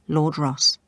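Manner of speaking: ordinary delivery, nothing marked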